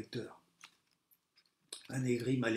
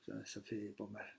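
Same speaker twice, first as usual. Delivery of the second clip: usual speaking voice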